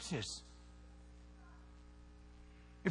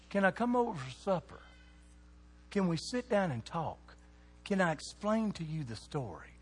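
soft, unhurried asking; gentle invitation; 6 dB quieter than usual. Acted. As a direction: invite someone kindly, with room between questions. Jesus' gentle invitation, against pushy churchgoers.

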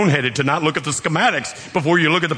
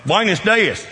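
"Buenos dias" in a Kentucky accent, high and loud; southern-accented Spanish; 8 semitones higher, 4 dB louder, slower than usual. Shown as a demonstration. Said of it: "my Spanish is horrible".

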